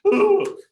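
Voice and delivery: high, loud panicked hoot; panicky "ooh". Retold or imitated. Thirteen-year-old dreading getting caught, mock-panicked hoot. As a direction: let out a hammed-up nervous whoop.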